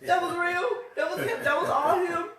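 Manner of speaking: high, loud, laughing insistence; cracking up as you insist, voice way up; real excited outburst, pitched high and loud; amazed insistence. Real, not acted.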